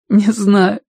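put-on high, sobbing boy's voice; tearful sob. A narrator's reading. Boy answers his friend about the killed cat, sobbing. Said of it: "всхлипнул Тенька".

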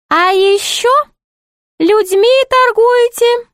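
very high, sing-song jester voice; put-on high jester voice; high-voiced jester. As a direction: play the court jester in a high, piping pitch.

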